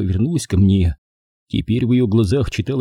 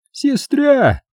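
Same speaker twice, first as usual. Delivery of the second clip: high-pitched drawn-out echo; incredulous exclamation. A narrator's reading. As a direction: repeat the word in high disbelief. Mike's incredulous echo of sister.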